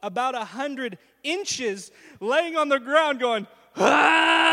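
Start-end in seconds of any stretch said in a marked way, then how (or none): 3.8-4.5 loud, high scream; retold scream. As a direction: scream flat on your back. Lying on ground going.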